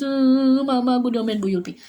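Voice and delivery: hammed-up sob, then a quick tattling complaint; mock crying. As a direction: pretend child wailing to mother. (pura-pura menangis mengadu ke Mama)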